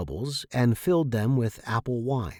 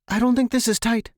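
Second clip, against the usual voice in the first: high and louder, a gasp of alarm; 10 semitones higher, 5 dB louder than usual. A narrator's reading. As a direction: gasp the words out, suddenly scared. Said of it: "she gasped".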